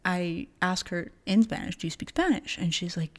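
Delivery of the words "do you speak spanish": rising, higher-pitched question; asking question. Retold or imitated. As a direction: lift SPANISH into a question. Tentatively asks mom about language.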